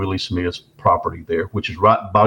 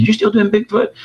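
friend's high voice; 12 semitones higher than usual. Retold or imitated. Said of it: "he said".

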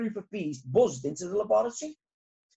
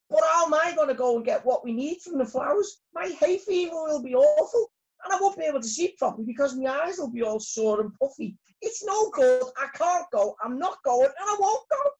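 loud, high protesting bee; raised-voice storybook fretting; too loud; 10 semitones higher, 5 dB louder than usual. A narrator's reading. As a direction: dig in and refuse, getting worked up. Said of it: "rather too loudly".

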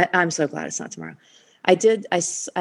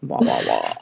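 very low croaky morning voice; frog voice. Shown as a demonstration. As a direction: croak it out, deep and rough. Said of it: "morning frog voice".

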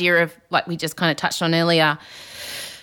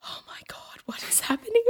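stunned whisper; aghast whisper; 10 dB quieter than usual. Retold or imitated. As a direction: whisper it in disbelief. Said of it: "Tina Fey must have been sitting there going".

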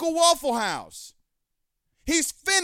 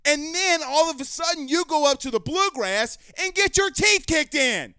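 fired-up, heavily punched scolding; angry rant; 3 semitones higher than usual. Real, not acted. Host berating the coach after a bad loss, angry rant.

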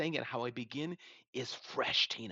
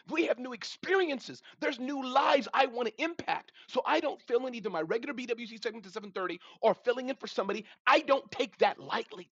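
fervent; 8 semitones higher than usual. Real, not acted. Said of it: "So sorry to get like that".

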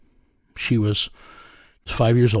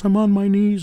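raised-pitch imitation of a sobbing, pleading mother; begging mother; 10 semitones higher than usual. Mother begging the detective on the phone, high pleading voice. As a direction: play the desperate mother begging on her knees. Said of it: "and she was begging me".

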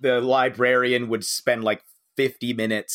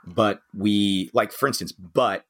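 ordinary talk

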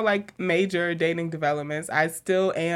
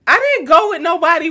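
fast, louder defensive denial; 9 semitones higher, 6 dB louder, faster than usual. Real, not acted. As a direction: brush off the question quickly. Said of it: "Why you saying it like that?".

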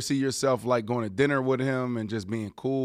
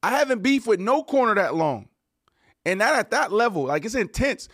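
excited; 6 semitones higher, 5 dB louder than usual. Real, not acted. Host insists loudly on intensity.

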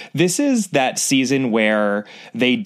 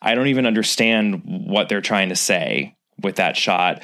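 ordinary talk, nothing marked

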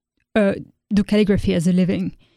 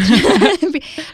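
a short real burst of laughter, higher and louder; amused laugh; 8 semitones higher, 4 dB louder than usual. Real, not acted. Guest laughs at how traditional calligraphers would react, amused laugh. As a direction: laugh out loud at your own joke.

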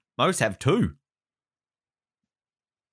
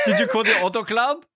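mock German accent; 10 semitones higher than usual. Imitating the sinister henchman for laughs, mock German accent. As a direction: put on a higher, comic German accent. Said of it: "most stereotypical German accent".

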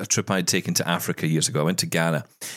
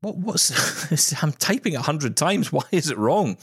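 higher, half-laughing puzzled complaint; mock bafflement; 7 semitones higher than usual. Typist told a word is misspelled, mock baffled. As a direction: act out baffled frustration at your own typing. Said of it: "And you think".

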